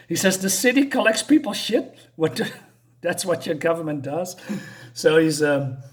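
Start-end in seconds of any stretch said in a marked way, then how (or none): 0.4-4.4 high mock-appalled disbelief breaking into laughter; scandalized question, pitched way up; shocked, retold. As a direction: retell utter bafflement, amused. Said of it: "shocked about what's happening".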